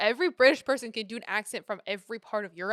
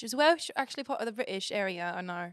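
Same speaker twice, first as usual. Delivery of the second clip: ordinary delivery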